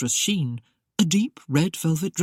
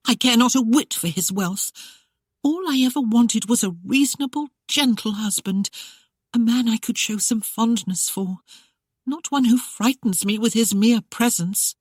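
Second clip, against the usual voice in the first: higher, raised voice, upset and indignant; 7 semitones higher than usual. Acted. Bride protesting to the housekeeper about her frightening husband, indignant. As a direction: pour out her grievance. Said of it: "in disgust".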